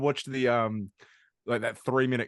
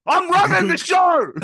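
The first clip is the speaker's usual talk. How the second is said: high, loud, drawn-out yell; mock bossy shout; 10 dB louder, slower than usual. Podcaster taking charge of the show, mock bossy shout. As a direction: bellow it slowly, claiming command. Acted outburst about running podcast.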